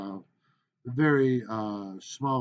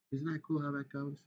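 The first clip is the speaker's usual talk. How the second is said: ordinary talk, nothing marked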